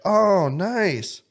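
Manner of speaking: high, loud, drawn-out fake admiration; mock enthusiasm. Brother teasing his sister about her rental, mock enthusiasm. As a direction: gush with put-on approval.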